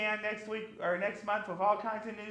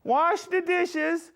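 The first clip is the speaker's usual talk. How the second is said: hammy mock king voice, lightly done; King Spilly; 4 semitones higher, 8 dB louder than usual. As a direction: grandstand like a goofy monarch candidate. Campaign speech as King Spilly.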